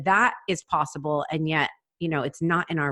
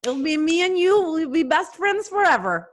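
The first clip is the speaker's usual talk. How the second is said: the voice on request; 11 semitones higher than usual. Pretend. Asked by her friend to do the voice, a sky-high giddy squeal. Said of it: "Oh, please, please do it".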